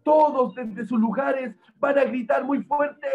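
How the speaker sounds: put-on high-pitched appeal to the children; rally a young crowd to the rescue; urgent rallying call. A narrator's reading. Narrator rallies children to help.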